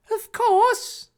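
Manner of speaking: very high, loud, magnanimous; generous declaration. A narrator's reading. Harry granting his friendship, high loud generous declaration. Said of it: "Harry declared generously".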